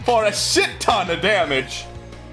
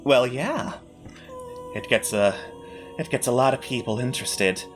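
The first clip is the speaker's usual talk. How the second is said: smug, patronising villain; 6 semitones lower, 5 dB quieter than usual. Acted. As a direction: purr it out like a gloating host. GM villain voice continues.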